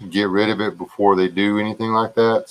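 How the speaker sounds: ordinary talk, nothing marked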